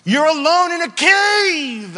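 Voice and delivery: high, loud, pressing shout; shouting preacher. Real, not acted. Preacher to congregation, apologising for shouting. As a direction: hammer home the loneliness. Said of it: "I'm sorry if I'm shouting".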